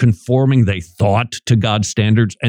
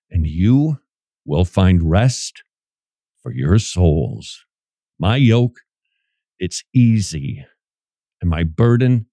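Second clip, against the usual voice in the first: 1 gentle; 6 semitones lower, slower than usual. Real, not acted. Tender reading of Jesus' words.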